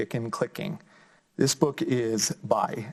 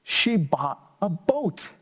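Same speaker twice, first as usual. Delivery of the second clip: put-on lively, sing-song story reading for kids; reading to children; slower than usual. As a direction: bring the picture book alive for little listeners. Reads picture book aloud.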